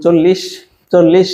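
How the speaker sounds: "চল্লিশ" with a lingering final sh; "চল্লিশ" said twice, stressing the -ish; drawn-out ish ending. Shown as a demonstration. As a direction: hold the hissed ending of "চল্লিশ". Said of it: "একটা ইস ইস ভাব আসছে না?".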